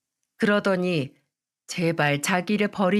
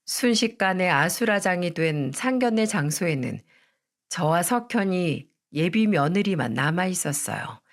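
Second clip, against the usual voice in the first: ordinary delivery, nothing marked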